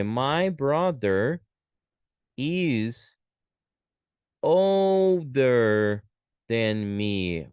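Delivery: very slow, stretched words with pauses; slow dictation. Shown as a demonstration. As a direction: dictate it word by word. Teacher writing out example sentence.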